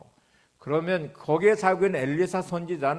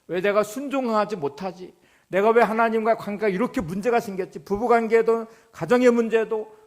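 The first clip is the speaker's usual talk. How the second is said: raised, higher-pitched rhetorical self-questioning; impassioned preaching; 6 semitones higher, 8 dB louder than usual. Acted. Preacher models the congregation questioning themselves before God, impassioned preaching. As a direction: pile up the searching questions with urgency.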